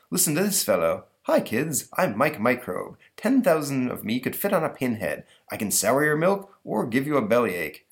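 playful talking-bug voice; cheery Mike Microbe. Acted. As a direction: introduce yourself like a kids' show microbe.

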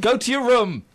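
loud scolding parent; stern parent shout. Pretend. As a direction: bark it like a cross dad. Parent scolding child in sketch.

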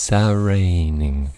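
Saraining said slowly as one word; drawn out blend. Shown as a demonstration. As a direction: stretch Saraining out, each sound clear. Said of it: "S A R A".

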